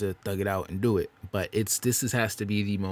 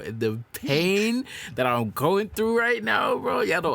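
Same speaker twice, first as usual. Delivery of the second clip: much higher, slow, hammed-up cry of suffering; mock anguish; 11 semitones higher, 5 dB louder, slower than usual. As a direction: wail it like a mock tragic hero. Complaining about the stressful songwriting.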